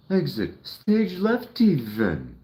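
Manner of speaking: high, loud, slow cartoon lion; theatrical cartoon cat exiting grandly; Snagglepuss. Imitating a cartoon lion's catchphrase for the viewers, high and hammy. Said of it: "how he said that".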